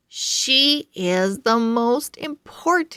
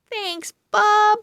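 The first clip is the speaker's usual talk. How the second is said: put-on high, bright girl thanks; cheerful girl voice; 10 semitones higher than usual. Bella thanks the pug for her lunch, sweet.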